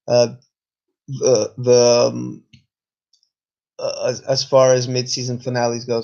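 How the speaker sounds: talking through hiccups; halting speech broken by hiccups. Real, not acted. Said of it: "got the hiccups here".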